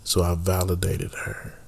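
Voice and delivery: breathy lament over a lost car; mock-tragic whisper. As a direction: whisper it like a tender loss. Mock-sentimental whisper about his car.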